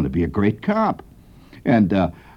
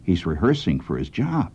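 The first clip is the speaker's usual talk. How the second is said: hushed, much softer voice; whispering; 4 semitones lower, 6 dB quieter than usual. Retold or imitated. Al whispering to his friend on the porch, hushed. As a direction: keep it low so the kid won't hear. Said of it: "And he whispers to me".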